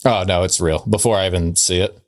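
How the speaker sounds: usual speaking voice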